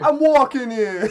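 slow, loud New York tough guy; New York accent. Pretend. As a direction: belt it like a New York cabbie. Joke street tough quote.

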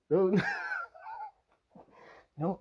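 ordinary delivery

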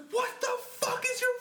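high, shouted mock girlfriend fury; retold angry yell. Storyteller quoting girlfriend after he threw up, mock-furious high yell. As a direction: shriek it like an outraged girlfriend.

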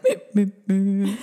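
high, slow little whimpering meeps; mock whimper. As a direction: imitate a tiny scared whimper. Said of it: "and I whimpered".

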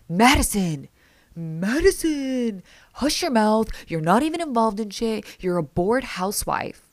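hammed-up retold yelling at someone; mock angry yell. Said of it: "gets so mad and he yells".

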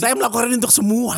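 high-pitched mock martyr declaration; mock-heroic. Comedian to host on blocking Fadlizon as spokesman, mock-heroic. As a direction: proclaim it like a hammed-up noble sacrifice. Jokes about his sacrifice for everyone.

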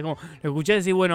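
ordinary talk, nothing marked

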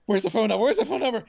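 mimicking a husband's sudden panic; panicked husband. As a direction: bark it out in a panic. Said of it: "He's like".

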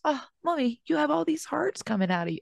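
put-on high, soft child voice; little boy's voice. Son telling mommy about her hearts, soft high child voice. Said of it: "And then he goes".

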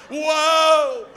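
loud, high, drawn-out whoa; big comic holler of alarm; stunned whoa. Retold or imitated. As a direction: belt out a shocked whoa. Reacting to cussing testimony.

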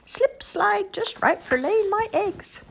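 high slow slippery critter; slow, high creature voice; salamander voice. Acted.

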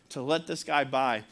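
usual speaking voice

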